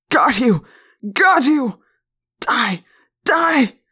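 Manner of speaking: hoarse shouting. A narrator's reading. Man clutching his ear yells at the Yeerk, hoarsely. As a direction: yell it in bursts, wilder the second time. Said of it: "shouting in a loud, hoarse voice".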